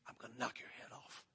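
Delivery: voiceless menacing whisper; whispered threat. Retold or imitated. Eight-year-old girl threatening her brother, whispered. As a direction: whisper it as a secret threat, very soft. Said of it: "She'll be whispering to him".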